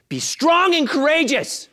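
high, slow, loud exhortation; rising to a shout. Acted. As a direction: build to a full-voiced cry. Third time, building to shout.

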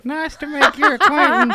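high, loud Southern character voice; Darlene. Acted. Darlene meeting Cliff, high Southern character. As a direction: greet him in a loud, high put-on twang. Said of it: "I'm Darlene".

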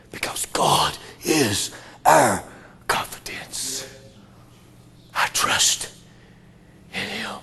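soft, slow, breathy whisper; whispered comfort to the frightened; tender whisper. Acted. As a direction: hush them into trust. Comforting close of verse.

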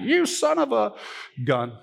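high hammed-up outburst; mock angry commenter. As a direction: spit it out like a mock-furious poster. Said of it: "the loudest ones on Facebook, being like".

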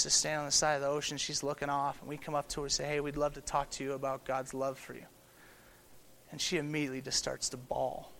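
soft, drawn-in telling of a tender moment; hushed storytelling. Acted. Pastor recounting the beach encounter, softly hushed. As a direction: pull the room in close and soften.

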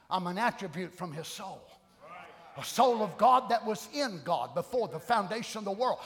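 ordinary talk, nothing marked

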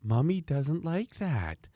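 mother gently scolding, high and very slow; mock sweet mother. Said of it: "And she goes".

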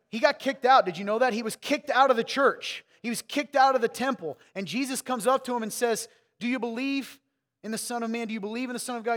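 high and loud, preaching at full force; fiery preaching. Acted. Pastor driving home the blind man's story, fiery preaching. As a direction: build the fire, preach it out.

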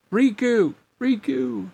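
high cries from far away; distant calling. A narrator's reading. Sora calling his fainting friend, high and distant. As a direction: call his name high, fading on the second. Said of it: "Sora's voice was so distant".

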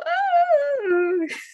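a high, bursting laugh of joy; delighted laugh. Real, not acted. Speaker overwhelmed by the saeta in the street, high joyful laugh. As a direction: laugh out in amazement. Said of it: "Y yo, ¡wow!".

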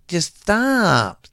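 put-on high, slow whiny kid voice; imitating the kids. Dad mimicking his kids, high and whiny. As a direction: whine it like a fed-up child. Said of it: "I hear that shit all the time from my kids".

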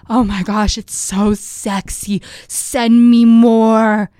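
slow, hammed-up British accent; imitating a British accent, drawn out. Group chat member gushing over pics, in mock British accent. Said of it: "they had a British accent or something".

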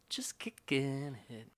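slow breathy whisper, "Just kicking it". Pretend. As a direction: whisper it slowly, laid back. Chill whispered tag.

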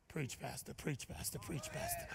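unvoiced low murmur to himself; whispered self-urging. Pastor urging himself on, whispered aside. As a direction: egg yourself on under your breath.

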